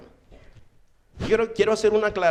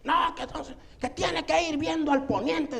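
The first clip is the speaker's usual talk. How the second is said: high mock voice, not really shouted; mock yelling woman; 9 semitones higher, 3 dB quieter than usual. Son mocking the superstitious woman at the burial in a high voice. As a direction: imitate the superstitious woman nagging in his ear. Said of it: "casi en la oreja me estaba gritando".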